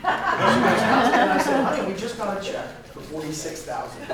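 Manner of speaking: laughing retelling. Retold or imitated. Retelling the wife exchange laughing.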